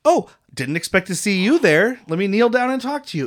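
lightly acted chance-meeting voice; feigned surprise. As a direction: play up the fake surprise. Acting out an awkward approach to child.

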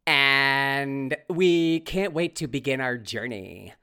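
slow, drawn-out upspeak parody; hammed-up dragging singsong; mock reality-show client. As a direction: stretch every vowel like a clueless TV guest. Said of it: "sound like children. Dumb children".

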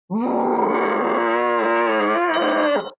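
long wordless monster howl; distant roar. Acted. Game master voicing a creature in the distance, long howl. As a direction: imitate a beast's drawn-out call far away. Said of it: "you hear in the distance".

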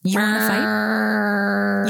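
slow, stretched taunt; hammed-up slow taunt at the cat; drawn-out mock challenge. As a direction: drag out the dare. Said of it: "Oh yeah?".

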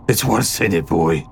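chuckling tough man, dropping low; catching his breath. A narrator's reading. One-eyed man after a belly laugh, catching breath. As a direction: let a laugh out, then answer gruffly. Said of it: "the man said, trying to catch his breath".

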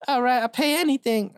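a desperate client pleading; frantic woman. Acted. Woman client begs the detective for help, high and squeaky. Lady agrees to fees.

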